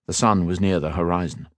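ordinary delivery, nothing marked

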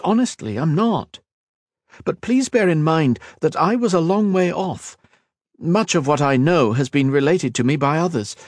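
high, placating elf voice; apologetic elf. Acted. Kira excusing herself to Kat, apologetic elf.

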